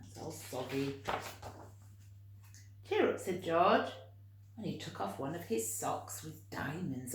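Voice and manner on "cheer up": gentle cheer up. Acted. George comforts fox.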